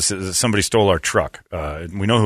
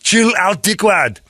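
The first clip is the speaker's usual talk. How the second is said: put-on higher, louder Austrian Arnold accent; 11 semitones higher, 3 dB louder, slower than usual. Customer brushing off the clerk in an Austrian accent. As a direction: shrug it off like Schwarzenegger in an Austrian accent. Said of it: "in that accent".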